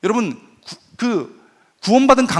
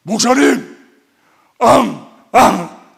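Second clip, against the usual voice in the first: mock crying man; 2 semitones higher, 6 dB louder, much slower than usual. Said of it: "형제는 굵직하게 보냈습니다".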